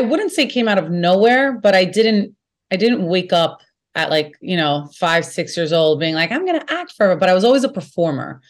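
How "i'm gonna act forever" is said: much higher, lightly voiced little kid; child self. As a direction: pipe it up like an eager small child. Imitating her child self.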